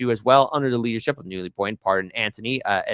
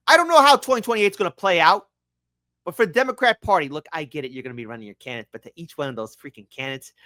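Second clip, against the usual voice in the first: ordinary delivery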